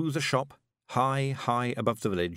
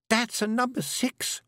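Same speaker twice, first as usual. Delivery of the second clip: ordinary delivery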